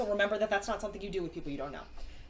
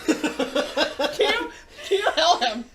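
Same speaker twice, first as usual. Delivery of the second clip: louder begging through laughter; louder, giggling mock plea; laughing plea; 4 semitones higher, 8 dB louder, much slower than usual. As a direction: beg for help while cracking up. Character's plea dissolving into laughter.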